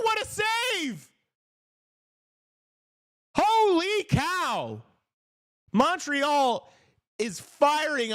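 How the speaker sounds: very high, drawn-out hockey shouts; thrilled commentator yelling at the top of the voice; excited play-by-play. Real, not acted. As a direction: lose it over a huge save. Calling big saves live.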